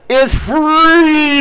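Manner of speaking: high, slow battle cry; freedom shout. Pretend. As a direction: yell it like Braveheart.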